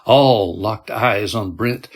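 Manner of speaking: ordinary delivery, nothing marked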